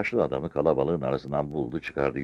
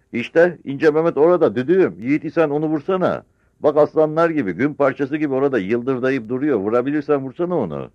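high, loud, excited goading; shouted taunt; 11 semitones higher, 7 dB louder than usual. Acted. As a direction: cry out a dare over the crowd. Old sergeant goading from the crowd. (coşkuyla bağırarak kışkırtan)

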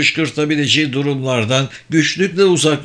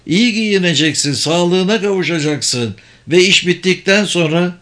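higher-pitched, assured mage; ikna edici büyücü; 5 semitones higher than usual. Acted. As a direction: sell the offer firmly in a higher voice. Sabır rebukes and bargains. (tiz perdeden, ikna edici büyücü sesi)

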